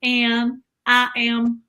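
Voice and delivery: very slow, drawn-out Southern drawl; Southern accent. Shown as a demonstration. Wife practicing a slow Southern accent on husband. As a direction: lay on a not-quite-there Southern accent. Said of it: "trying to learn my Southern accent".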